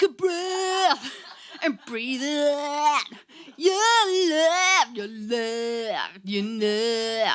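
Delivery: a light, slowed mock of Nick's singing; imitating Nick. Singer teasing bandmate Nick at the gig, mock imitation. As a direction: tease him by copying how he sings it, drawn out. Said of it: "do my imitation of Nick doing it".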